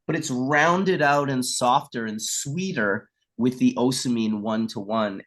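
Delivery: usual speaking voice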